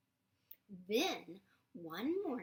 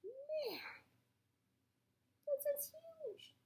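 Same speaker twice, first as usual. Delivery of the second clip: very high, slow amazed voice; awed bear; slower than usual. Retold or imitated. Bear on the escalator, high and amazed. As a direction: marvel at the huge mountain. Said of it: "He's like".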